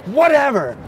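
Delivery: high, dismissive mock yell; re-enacted brush-off; imitated yelling. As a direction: toss it back at a high pitch. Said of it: "yelling at me".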